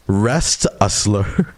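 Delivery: drawn out slowly, joking; slow mock pun. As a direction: stretch each word, teasing. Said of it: "Another spelling error".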